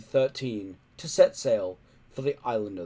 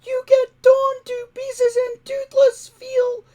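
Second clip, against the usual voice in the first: high, stammering whine, near tears; squeaky stuttering dragon, close to sobbing; whining dragon; 13 dB louder, slower than usual. A narrator's reading. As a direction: whine it like a frightened little dragon. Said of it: "whined Toothless".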